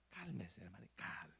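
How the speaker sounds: soft whisper to settle him down; voiceless retold calming. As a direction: hush him gently. Calming the pushy brother.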